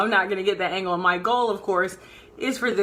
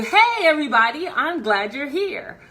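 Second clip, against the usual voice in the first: brighter, higher, eyebrows raised and smiling; energized greeting; 7 semitones higher, 6 dB louder than usual. Shown as a demonstration. Coach showing viewers an energized live stream greeting. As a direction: lift it with a smile, higher and louder. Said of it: "All I did was raise my eyebrows and smile".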